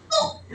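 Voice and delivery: high squeaky pop through a laugh; popping sound, laughing. Retold or imitated. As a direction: make the gum pop with a giggle. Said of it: "Tapos ginanon niya".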